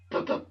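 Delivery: quick puffing stuttered breaths; mock hitching breaths before a cry; imitated pre-cry breaths. Kid trying not to cry, puffing pre-cry breaths. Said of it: "those pre-cry breaths".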